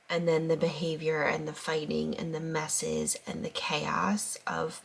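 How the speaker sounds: hushed, teary whisper; barely holding it together after tears; after crying. Real, not acted. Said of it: "feeling very overwhelmed".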